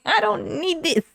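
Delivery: high, slow squealed protest with laughing; hammed-up whine, fighting tears with laughter; mock near-tears. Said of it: "Just to keep from crying, I laughed".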